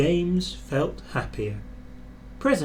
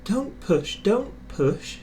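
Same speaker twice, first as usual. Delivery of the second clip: puffing protest; 6 semitones higher, slower than usual. A narrator's reading. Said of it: "puffed Edward".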